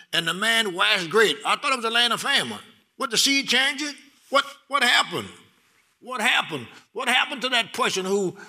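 rising sermon fervor; preaching build. Acted. Preaching with raised voice.

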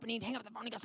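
ordinary talk, nothing marked

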